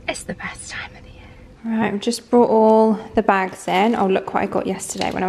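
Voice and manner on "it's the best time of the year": whispering. Real, not acted. Cosy autumn aside to camera.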